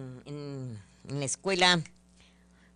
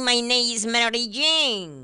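higher, slow, put-on voice of a pupil; mock student answer; 5 semitones higher, 6 dB louder, slower than usual. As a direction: recite it like a pupil in class.

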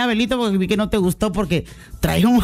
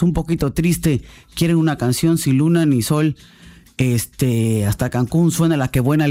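gentle condolence; 6 semitones lower than usual. Real, not acted. Host consoling a grieving listener, tender and low. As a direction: soothe a listener who lost family.

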